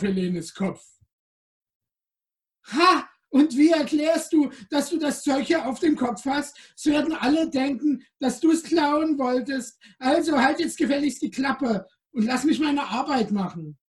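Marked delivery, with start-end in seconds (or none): 2.6-13.8 gleeful squeak. Acted. The little creature taunts Helene in a high gleeful squeak. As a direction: pipe it out, triumphant and cheeky. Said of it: "piepste das kleine Ding".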